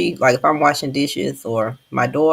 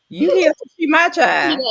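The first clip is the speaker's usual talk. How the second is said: loud, high, slow put-down; emphatic retort; 11 semitones higher, 6 dB louder than usual. Retold or imitated. Grandmother retells correcting her own daughter, loud emphatic retort. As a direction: retell laying down the law, drawn out and raised.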